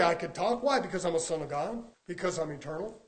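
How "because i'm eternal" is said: normal talking voice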